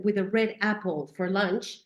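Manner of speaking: ordinary delivery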